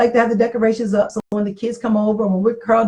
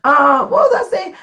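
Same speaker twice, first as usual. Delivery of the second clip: laughing; 7 semitones higher than usual. Real, not acted. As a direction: laugh it off and lose the thread. Laughing after brother-in-law joke.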